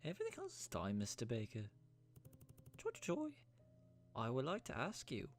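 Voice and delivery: put-on high-pitched, wobbly British Isles accent; Irish or English accent. Game character addresses Mister Baker, in an Irish-English accent. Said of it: "am I doing an Irish accent".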